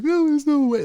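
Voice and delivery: buddy's squeaky-high protest, imitated; high-pitched mock disbelief. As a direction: mimic a kid piping up from the backseat, very high and loud. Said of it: "he had this high-pitched voice".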